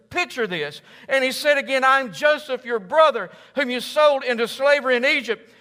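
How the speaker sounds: loud, high, driving sermon voice; impassioned pulpit build; full-force preaching. Acted. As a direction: preach it out to the back row.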